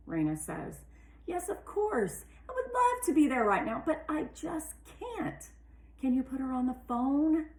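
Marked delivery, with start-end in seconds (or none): 1.2-7.5 pleading daughter on phone. A narrator's reading. Raina pleading with mother on phone.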